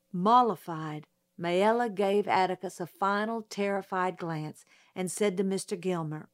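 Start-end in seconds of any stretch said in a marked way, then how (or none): none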